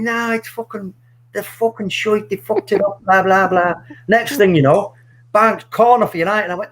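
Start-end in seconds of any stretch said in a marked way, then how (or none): 0.0-3.7 put-on a light higher Irish accent, grumbling; dad's Irish accent. Son voices his Irish dad writing off United, Irish accent. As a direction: dismiss the team in Dad's Irish voice. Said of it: "I won't do his Irish accent".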